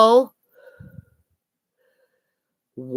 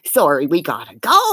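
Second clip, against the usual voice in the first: ordinary delivery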